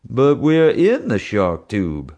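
slow, drawn-out frightened wail. A narrator's reading. Bubba realising he is trapped in the shark tube, slow wail. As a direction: stretch it out like a scared kid. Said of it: "Bubba wailed".

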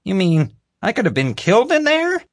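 high, rising frightened question; sudden fear dawning on her; alarmed. A narrator's reading. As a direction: voice jumping up in alarm. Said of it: "Marge paled".

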